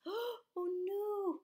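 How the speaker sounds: high, crying worried mum voice. Acted. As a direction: wail it like a frantic mother. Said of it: "looking very worried like this".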